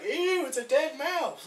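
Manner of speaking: high, drawn-out squeal of ew; disgusted. Acted. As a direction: recoil loudly, stretching the words. Acted disgust at dead mouse.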